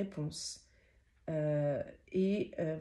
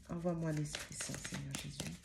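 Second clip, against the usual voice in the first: softer, lower murmured invocation; quiet prayer; 5 semitones lower, 8 dB quieter than usual. Real, not acted. Reader asking Jesus for the Holy Spirit, softly and lower. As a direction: pray it under your breath. Prays to guides before drawing.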